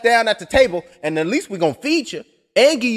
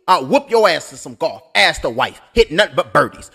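ordinary talk

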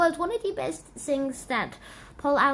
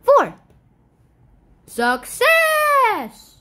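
triumphant shout; 9 semitones higher, 9 dB louder, much slower than usual. Pretend. Triumphant result.

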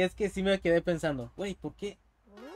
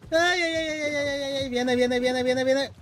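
high, loud alarmed ay ay ay; panicked cries; 7 semitones higher, 3 dB louder than usual. Real, not acted. Streamer panicking as the obstacle approaches, alarmed. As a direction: yelp as the danger comes. Obstacle coming at him in game.

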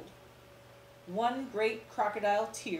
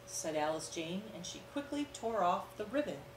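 ordinary delivery

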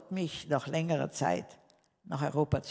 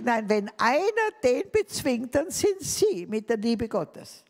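put-on higher, slow, earnest older woman; old wife; 7 semitones higher, 5 dB louder, slower than usual. The boss's wife answering her, higher and slow. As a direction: lift the voice and slow down like the elderly wife.

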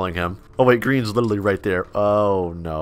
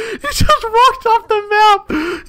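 squeaky, slow words through helpless laughter; barely gets it out for laughing; 5 dB louder, much slower than usual. Real, not acted. Laughing as he tells the viewers the noob fell off.

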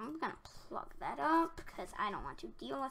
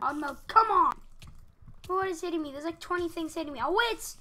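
loud, higher, frantic; real panicked yelling; 6 semitones higher, 13 dB louder, faster than usual. Real, not acted. Player hit by twenty things at once, panicked. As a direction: shout in rising panic. Attacked by many mobs.

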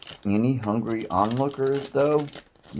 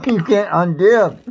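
laughing; 9 semitones higher, 10 dB louder than usual. Real, not acted. Laughing scolding over food.

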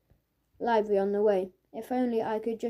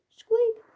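very high, loud squeak; mouse squeak; 13 semitones higher, 5 dB louder than usual. Acted. Doodling joke names for Marcus, squeaky mouse noise.

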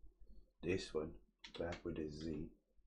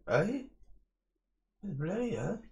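high, startled eh; surprised; 13 semitones higher, 7 dB louder, slower than usual. Real, not acted. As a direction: yelp in surprise at the mix-up. Surprised at his own mistake.

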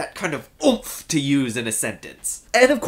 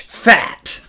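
"Fat" spat out louder; mock curse; 6 dB louder than usual. Shown as a demonstration. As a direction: swear the word "Fat". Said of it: "Chip and its backwards partner pitch".

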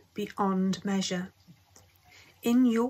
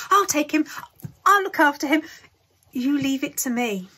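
put-on loud, high, eager old man; 5 semitones higher, 6 dB louder than usual. A narrator's reading. Grandpa Joe begging to take Charlie, loud and eager. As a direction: insist with eager loudness.

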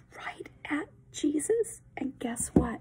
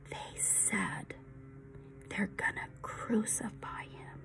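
whispered, much softer, breathy dread; hushed whisper; 4 semitones lower, 14 dB quieter than usual. Acted. Eli whispers the crucifixion news to Benjamin. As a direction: whisper the awful secret.

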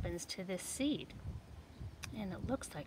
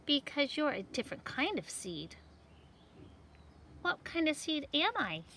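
high, bright bickering seed voices; squabbling seed voices; 6 semitones higher than usual. Acted. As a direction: play the seeds arguing for kids. Seeds argue in picture book.

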